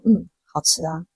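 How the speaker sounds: soft, stiff, joyless "yes it's tasty"; a mild imitation of a forced polite answer; stiff forced reply. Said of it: "两个人脸就超僵". (轻声僵硬地敷衍回答)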